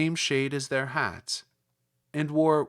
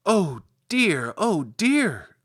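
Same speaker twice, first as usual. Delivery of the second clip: high, loud cry of dismay; 4 semitones higher, 6 dB louder, much slower than usual. Acted. Dorothy sees the witch's feet, high loud dismayed cry. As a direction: cry out in alarm at what she sees. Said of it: "cried Dorothy".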